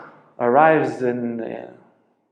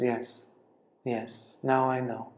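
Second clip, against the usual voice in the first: serene quiet answer; 9 dB quieter than usual. Retold or imitated. Dying master's calm answer.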